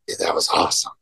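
low, nearly voiceless starstruck mumble; shyly gushing at his hero, barely voiced; Chris Farley fanboy. Telling his skate hero the clip was awesome, low and awestruck. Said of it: "Chris Farley moment".

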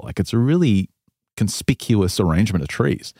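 ordinary delivery

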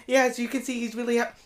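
girl starting her dog video, mock influencer cheer; vlogger intro. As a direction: imitate a chirpy influencer opening. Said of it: "she's like".

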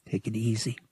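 calm her down quietly; soothing. Acted. Vic steadying trembling Bria, soft and soothing.

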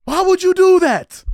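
slow, high, full-voiced yell; exasperated shout. Demanding why he said that on TV, drawn-out exasperated shout. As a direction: shout it high, stretching every word in disbelief. Repeats acted reaction, now shouted.

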